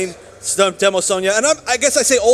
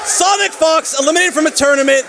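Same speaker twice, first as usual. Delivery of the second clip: excited shout; 7 semitones higher, 4 dB louder than usual. Real, not acted. Caster calls out a big result.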